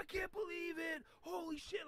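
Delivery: very high mock shout of disbelief; imitated amazed onlooker. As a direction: cry out in hammed-up amazement. Acting amazed onlookers in another video.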